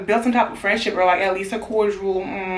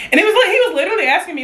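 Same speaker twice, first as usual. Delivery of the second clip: fast, high, laughing; quick laughing lead-in; 9 semitones higher, 4 dB louder, much faster than usual. Real, not acted. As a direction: rattle it off laughing. Laughing while retelling.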